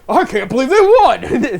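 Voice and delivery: high, loud, laughing through it; can't stop laughing at the win; laughing disbelief. Retold or imitated. Twenty years later, laughing disbelief. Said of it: "Twenty years later".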